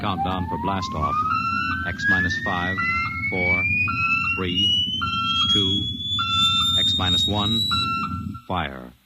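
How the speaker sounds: deliberate launch countdown call; countdown announcer. Acted. Announcer counting down the rocket launch, deliberate. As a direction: count down each number to blastoff.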